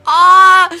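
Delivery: loud, high, drawn-out "ah"; touched cry. Real, not acted. Streamer finishing a viewer's kind message, loud touched cry. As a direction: let out a big gushing cry.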